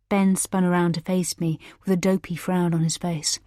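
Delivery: plain reading voice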